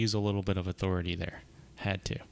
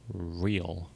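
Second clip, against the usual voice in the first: lightly put-on aristocratic R on "real"; a hint of a mock aristocratic accent on "real"; 2 semitones lower than usual. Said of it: "pronounces the R with a mock aristocratic accent".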